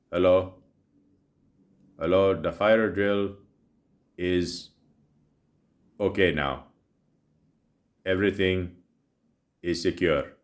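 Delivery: imitating the announcer with a mild Indian accent, drawn out. Imitating a building announcer over the speakers, mild Indian accent. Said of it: "I try not to do the Indian voice".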